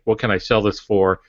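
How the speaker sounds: ordinary delivery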